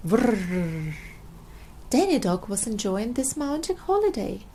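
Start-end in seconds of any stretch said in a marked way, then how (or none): 0.1-0.9 engine noise. Acted.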